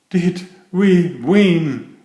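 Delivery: slow, breathless question after the race. A narrator's reading. Percy out of breath at the buffers, asking breathlessly. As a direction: puff out the words, winded. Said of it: "he said breathlessly".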